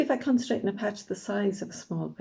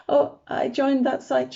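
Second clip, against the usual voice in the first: lightly voiced mum; 6 semitones higher, 6 dB louder, slower than usual. A narrator's reading. Mum's offhand reveal.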